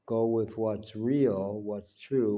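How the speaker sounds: ordinary talk, nothing marked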